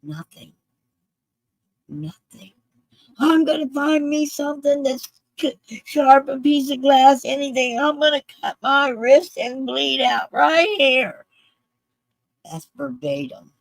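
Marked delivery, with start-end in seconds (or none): 0.0-2.5 very soft, near whisper; hushed disbelief. Real, not acted. Telling how two cops did nothing, hushed. 3.2-11.2 high-pitched desperate threat, sustained; retold screaming. Veteran threatening himself near the cops, desperate retold screaming. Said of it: "he's screaming it".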